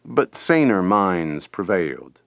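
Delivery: ordinary delivery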